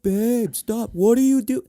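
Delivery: high-pitched fake protest; bad TikTok acting. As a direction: overact a fake complaint to a partner. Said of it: "when you can tell someone's acting badly".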